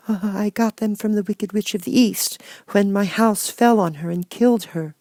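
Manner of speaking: put-on a meek little girl finding courage; little Dorothy. Dorothy replies.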